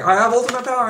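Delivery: very high, loud gloating shout; villain boast. Retold or imitated. Retelling the villain's gloat to a friend, high and loud. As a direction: gloat it out with mock triumph. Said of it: "so you're like".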